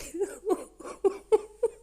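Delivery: high-pitched burst of laughter; high giggle. Real, not acted. Host cracks up at own naughty remark, high laugh.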